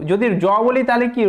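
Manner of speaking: ordinary talk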